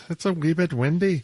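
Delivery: put-on light stage Irish accent; mock Irish accent. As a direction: keep the fake Irish going. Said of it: "They say wee bit".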